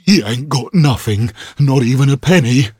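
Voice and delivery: loud, high rough thug. Acted. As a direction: grumble at the empty pockets. Thug complains to partner.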